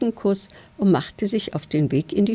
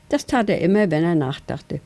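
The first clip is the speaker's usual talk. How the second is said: ordinary delivery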